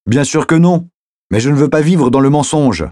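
high, emphatic protest; agitated reply over traffic noise; heated insistence. Acted. Heated answer in a quarrel.

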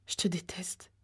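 very soft, hushed murmur; hateful murmur. A narrator's reading. Girl to the cruel man she hates, a hushed murmur. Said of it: "murmura Ginny".

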